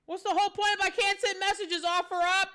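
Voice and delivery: loud, high frustrated yell; furious outburst. Real, not acted. Streamer raging at the app that won't send messages, loud and high. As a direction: blow up at the broken app.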